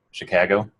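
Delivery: Chicago accent, "Chicago" with a flattened "ka" as in cat; local Chicago accent. Shown as a demonstration. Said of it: "there are local accents to Chicago".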